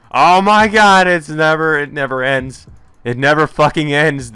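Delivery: loud, high wail easing off; exasperated yell. Real, not acted. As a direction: cry out at endless repetition, then subside. Reader exasperated by endless repetition.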